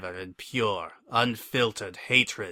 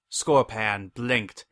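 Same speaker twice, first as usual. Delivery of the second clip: ordinary delivery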